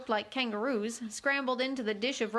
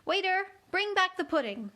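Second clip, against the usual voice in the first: calling out; 8 semitones higher than usual. Acted. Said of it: "she called out".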